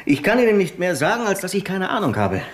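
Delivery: high-pitched exasperation; finally losing patience; exasperated. Acted.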